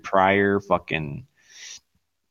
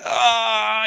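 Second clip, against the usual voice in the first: mock yelp; 9 semitones higher than usual. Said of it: "he's like".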